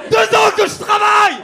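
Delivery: high, loud fake outburst; mock outraged shout. Real, not acted. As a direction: yell it in comic outrage. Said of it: "je me calme". (cri d'indignation comique)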